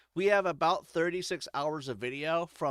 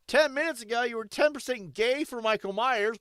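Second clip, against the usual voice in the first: ordinary talk, nothing marked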